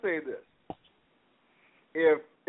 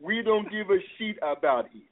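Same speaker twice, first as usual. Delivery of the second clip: put-on African accent; 7 semitones higher than usual. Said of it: "and I quote".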